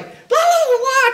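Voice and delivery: very high, loud babbling; imitating the friends babbling on; mock squeaky chatter. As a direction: babble like oblivious drunk friends. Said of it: "they are just like".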